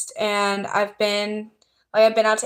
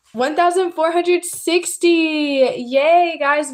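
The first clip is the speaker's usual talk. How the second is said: higher and louder, slow counting then a cheer; excited cheer; 7 semitones higher, 4 dB louder, slower than usual. Real, not acted. To her live viewers on nearing fifteen hundred, a real excited cheer. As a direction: celebrate the count with the audience. Reaching a subscriber milestone live.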